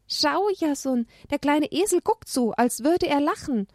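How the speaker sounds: put-on high happy child voice; delighted little girl. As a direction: point it out in wonder.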